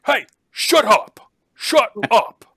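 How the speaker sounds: loud, high yelling; shouting shut up. Acted. Jailer yelling at the noisy creatures, loud high yell. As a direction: roar at them to shut up. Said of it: "just says".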